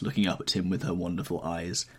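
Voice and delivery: usual speaking voice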